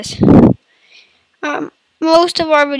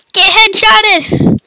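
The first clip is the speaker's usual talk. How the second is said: slow high taunt; 8 semitones higher, 3 dB louder, much slower than usual. Acted. Taunt repeated, higher voice.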